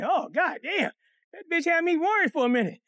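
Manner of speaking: ordinary talk, nothing marked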